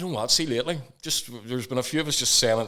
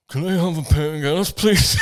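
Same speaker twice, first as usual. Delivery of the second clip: high-pitched put-on speech impediment; mock a cleft palate voice, louder on the last word; 4 semitones higher, 5 dB louder than usual. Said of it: "I've got a cleft palate".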